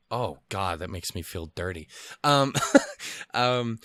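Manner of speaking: usual speaking voice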